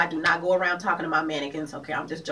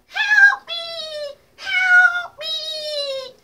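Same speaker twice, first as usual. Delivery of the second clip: squeaky high cry for help; tiny trapped fly crying for help; 6 dB louder, much slower than usual. Retold or imitated. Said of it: "that comes from the movie The Fly".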